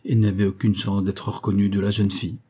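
usual speaking voice

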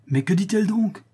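high-pitched, astonished exclamation; exclaiming. A narrator's reading. Father reacting to the countess's plea, exclaiming. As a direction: cry out in disbelief. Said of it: "s'écria mon père".

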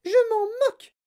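put-on very high, loud child shriek; Veruca's yell. A narrator's reading. Spoilt girl defying her mother, yelling. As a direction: scream it back. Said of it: "hurla Veruca".

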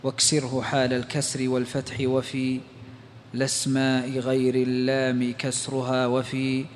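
measured, low recitation of a didactic poem; verse recitation. Real, not acted. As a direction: read the verse softly and evenly, lingering on line ends. (إلقاء منظومة بصوت منخفض ومتأنٍّ)

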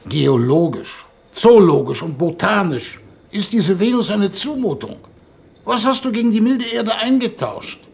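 indignant outburst. Acted. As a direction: work yourself up into a furious tirade. Envoy's indignant outburst against Venus.

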